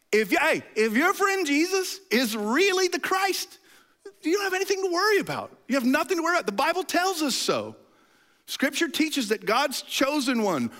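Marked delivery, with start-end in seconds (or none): 0.1-7.8 much higher, earnest voice of Jesus; Jesus speaking. Acted. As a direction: play Jesus reassuring his friends, pitched well up. Preacher voices Jesus to disciples.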